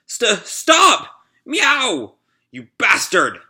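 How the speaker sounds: high, loud furious cry; angry yell. Acted. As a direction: scream at the attacker to stop. Cat character yells at attacker.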